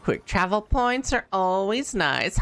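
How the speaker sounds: ordinary talk, nothing marked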